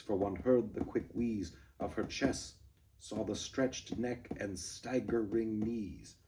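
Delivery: recite, breaking rhythm; poem reading. Shown as a demonstration. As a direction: read the stanza aloud. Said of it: "sixth stanza".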